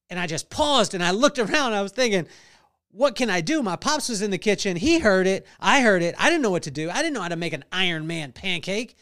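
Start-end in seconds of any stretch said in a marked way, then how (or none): none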